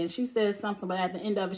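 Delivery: normal talking voice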